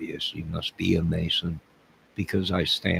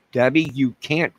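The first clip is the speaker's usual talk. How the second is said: ordinary delivery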